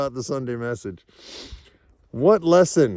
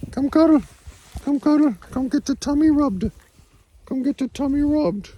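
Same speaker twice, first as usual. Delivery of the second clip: much higher sing-song pet voice. Real, not acted. Cooing to her dog Luna.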